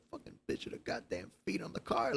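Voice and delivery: whispered angry grumble; mumbling to himself. Retold or imitated. Angry stranger mumbling about feet on car, whispered grumble. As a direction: hiss it under your breath. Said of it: "you could hear him mumbling to himself".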